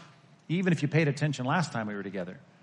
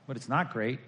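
ordinary talk

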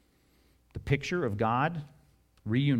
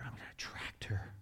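whisper; 4 semitones lower, 14 dB quieter than usual. Acted. God voiced courting his wife.

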